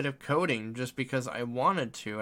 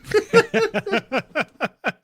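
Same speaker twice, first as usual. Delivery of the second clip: big high laugh; loud burst of laughter; 10 dB louder than usual. Real, not acted. Laughing at the joke.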